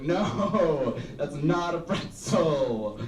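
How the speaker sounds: slow, emphatic no, stress on not; disgusted realisation. Retold or imitated. Child self after poking it, slow emphatic disgusted no. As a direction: recoil as it dawns. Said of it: "and I was like".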